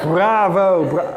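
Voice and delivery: high, loud "bravo" cut short; noisy cheer. Retold or imitated. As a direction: burst out cheering, then break off. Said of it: "first person to make noise".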